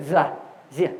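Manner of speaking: 자 and 지 begun with a buzzed English Z; z-like consonant. Shown as a demonstration. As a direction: demonstrate "자, 지" with a voiced z sound in place of ㅈ. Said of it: "제트 발음 비슷해요".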